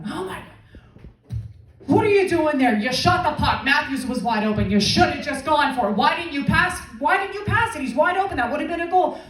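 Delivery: high-pitched, worked-up scolding; frantic locker-room dressing-down, played for laughs; coach freaking out. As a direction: rant at the player, voice climbing. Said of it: "Coach starts freaking out".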